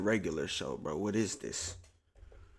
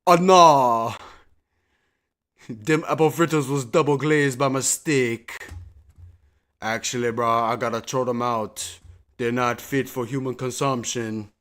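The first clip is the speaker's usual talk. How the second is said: loud mock Jamaican baker accent; 7 dB louder than usual. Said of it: "it's a Jamaican dude".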